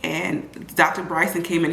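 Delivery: normal talking voice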